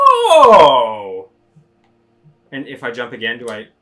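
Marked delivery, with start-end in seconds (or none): 0.0-1.3 surprised shout. Real, not acted. Jumps in game.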